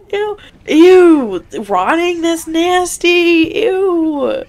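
high, drawn-out disgust; grossed out. Real, not acted. Gamer reacting to a rotting corpse, grossed out. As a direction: recoil with a long, high-pitched ew.

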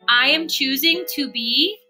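ordinary delivery

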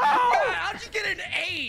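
shouting. Real, not acted. Disbelief at bowling score.

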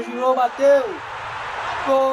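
rising yell on the goal; goal shout. Real, not acted. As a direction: erupt as the ball goes in. Goal scored, narrator erupts.

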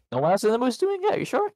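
very high teasing incredulous voice; mock disbelief. Real, not acted.

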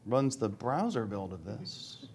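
slow, soft and drawn out, waiting on a stuck demo; stalling repeat. Real, not acted. Presenter repeating himself while the demo stalls, slow and quiet. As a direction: stretch it out quietly, as if coaxing the demo to respond.

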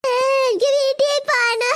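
slow wail, homesick; drawn-out tearful whine; crying child. Acted. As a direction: sob and whine to go home. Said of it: "കരയാതെ മോളെ".

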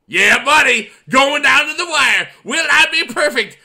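loud, high rallying shout; pumped, full-volume excitement; hyped-up yelling. Real, not acted. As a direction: yell it like the finish is seconds away. Hyped as game nears the end.

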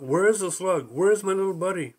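frantic, calling for his lost friend; freaking out. Acted. Mountain frantically calling for the missing Slug, panicked and loud. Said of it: "The mountain is freaking out".

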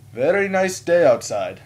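high, loud, slow imitation of small talk; chatty stranger. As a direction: imitate an over-cheery passerby. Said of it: "today he goes".

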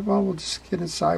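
normal talking voice

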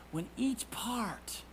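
slow whispered emphasis. Real, not acted. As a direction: lean in and whisper slowly. Preacher drops to a whisper.

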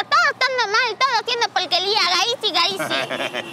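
high-pitched mock scolding of the boss; exasperated parody of a shrill tirade; imitated nagging boss. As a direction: mimic her grumbling, last words drawn out. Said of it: "todo el día grita".

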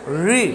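री with tongue curled back to the roof; heavy curled-tongue री; retroflex ri. Shown as a demonstration. Teacher showing how to say it, retroflex री. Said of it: "आपको बोलना क्या ऐसे".

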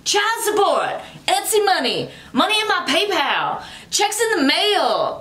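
mock chant out the car window; retold scream. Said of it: "and I was screaming".

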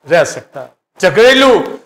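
usual speaking voice

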